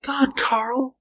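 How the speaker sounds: very high, alarmed female character voice; frightened trembling. A narrator's reading. Woman alerting her companion to the ape-man, frightened. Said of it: "she whispered in trembling tones".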